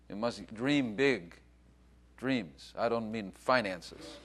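normal talking voice

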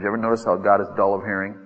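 usual speaking voice

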